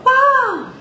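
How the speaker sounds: mock wrong head-voice wow; flipped wow. Shown as a demonstration. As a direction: show the wrong flip. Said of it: "I'm not gonna go".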